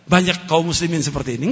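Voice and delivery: ordinary talk, nothing marked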